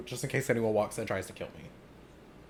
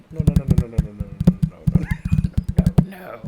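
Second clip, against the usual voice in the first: loud protest; 3 semitones higher, 4 dB louder than usual. Real, not acted. Protesting loudly.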